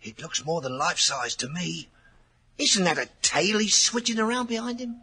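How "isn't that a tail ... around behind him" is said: higher, alarmed rising question; spooked, voice jumping up in fear; nervous servant sure he sees a devil; frightened. Acted. Frightened men watching the chapel.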